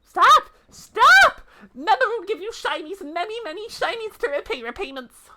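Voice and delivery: very high, shouted little creature voice; a tiny beast crying out to stop him; squeaky creature. Acted. Creature begging the bailiff to stop, high squeaky shout.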